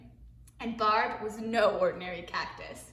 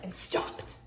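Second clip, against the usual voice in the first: whispered aside; 12 dB quieter, much faster than usual. Real, not acted. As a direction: secret whisper to listeners.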